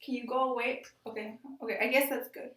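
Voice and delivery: ordinary delivery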